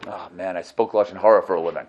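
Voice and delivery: low, deflated prisoner voice, rushing the confession; an inmate owning up to his crime, low and beaten; gloomy inmate confession. Acted. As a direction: mutter it like a resigned convict. Inmate in Gehinnom answers.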